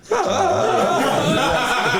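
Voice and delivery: high, loud jungle-swinging yell, hammed up; George of the Jungle scream. Player imitates the character's fall, George of the Jungle scream. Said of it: "do a George of the Jungle, like, scream".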